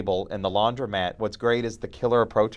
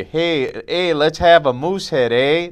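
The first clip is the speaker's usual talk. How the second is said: mock Canadian; 4 semitones higher, 4 dB louder, slower than usual. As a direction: put on a cartoon Canadian accent. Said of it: "they don't say".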